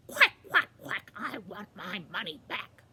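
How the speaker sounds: explosive loud quacks, then duck voice; demanding duck. Acted. Quackling confronting the castle guards, loud quacks and duck voice. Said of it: "he said".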